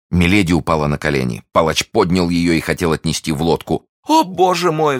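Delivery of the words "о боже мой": very high, loud anguished wail; despairing cry. A narrator's reading. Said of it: "вскричала она". (вопль отчаяния)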